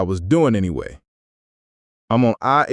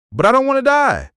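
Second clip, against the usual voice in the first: much higher, pleading whine; whiny plea. Acted. Narrator pleading with the minister, high whiny plea. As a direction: beg like a scared kid.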